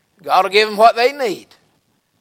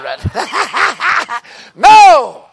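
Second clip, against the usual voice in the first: laughing shout; 9 semitones higher, 8 dB louder than usual. Real, not acted. Preacher shouts at congregation, laughing.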